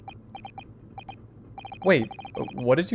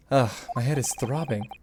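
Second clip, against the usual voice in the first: usual speaking voice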